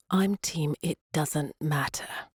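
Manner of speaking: slowly, deadpan and evasive; slow dry answer. A narrator's reading. Liam dodging Claire's royal question, slow deadpan. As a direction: draw it out, unbothered.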